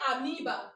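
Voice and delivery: amoeba said a-MEE-ba, no O sound; right pronunciation. Shown as a demonstration. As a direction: say amoeba with "oe" as a long ee. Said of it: "where is O?".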